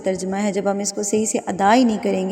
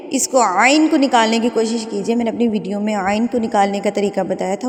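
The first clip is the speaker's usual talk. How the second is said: ordinary talk, nothing marked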